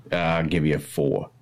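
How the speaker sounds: put-on haggling in a Maine accent; a Down East Maine accent, bargaining. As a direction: Maine flea-market dickering. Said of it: "You actually sound like you're from Maine".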